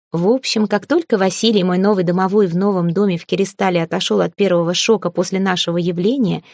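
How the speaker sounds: ordinary delivery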